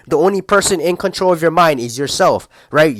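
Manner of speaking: ordinary delivery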